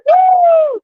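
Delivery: very high, loud whoop; bright high "woo"; excited cheer. Retold or imitated. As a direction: cheer a toddler down the slope. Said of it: "And I was like".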